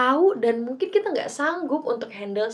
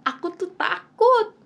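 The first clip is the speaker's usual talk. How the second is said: imagined fear; 9 semitones higher, 4 dB louder than usual. Retold or imitated. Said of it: "aku kebayangin dalam kurung kayak".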